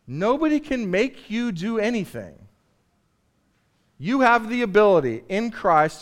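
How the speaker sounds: usual speaking voice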